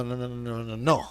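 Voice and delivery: usual speaking voice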